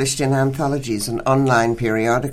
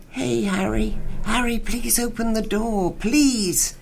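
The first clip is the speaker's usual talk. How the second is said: pleading; 9 semitones higher, slower than usual. Acted. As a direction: beg Harry to open the door, lightly voiced. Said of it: "But then she renewed her pleading".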